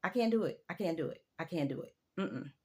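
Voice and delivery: repeated, fading to quiet; fading refusal. Real, not acted. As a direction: let each repeat die away. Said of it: "I just can't".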